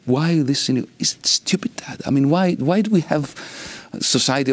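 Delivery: puzzled little girl pestering her dad, lightly imitated; imitating young daughter. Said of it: "She would come to me and say".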